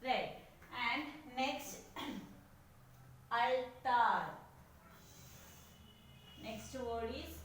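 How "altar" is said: "altar" as al... tar, louder; split into syllables. Shown as a demonstration. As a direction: punch out both syllables of "altar" separately. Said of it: "two syllables are there".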